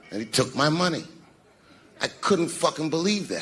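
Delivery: ordinary talk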